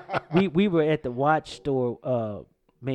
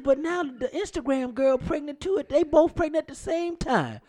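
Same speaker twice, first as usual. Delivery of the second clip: put-on quoting the girl; 12 semitones higher, 4 dB louder than usual. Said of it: "She said".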